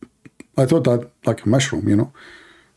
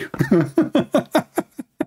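high laugh; 12 semitones higher than usual. Real, not acted. Laughs at own story.

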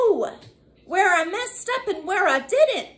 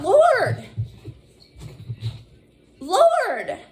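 higher, louder plea; crying out; 5 semitones higher, 3 dB louder than usual. Retold or imitated. Retelling crying to the Lord, louder plea. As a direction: call out to God in need. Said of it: "I cried out to the Lord".

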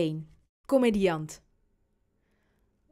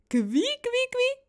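tickle squeak; slower than usual. A narrator's reading. Dad's fingers descending on son's belly, squeaky. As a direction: squeak rising to very high.

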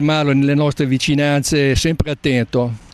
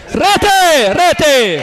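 goal shout; 13 semitones higher, 5 dB louder than usual. Real, not acted. Penalty goal, commentator erupts.

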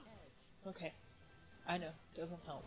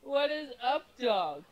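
falling for the joke; 5 semitones higher, 7 dB louder, slower than usual. Retold or imitated. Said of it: "Aaron goes".

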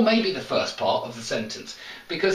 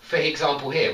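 ordinary talk

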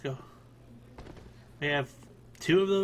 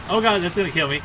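panicked shout; 5 semitones higher, 9 dB louder than usual. Real, not acted. Panicking at exploding enemy.

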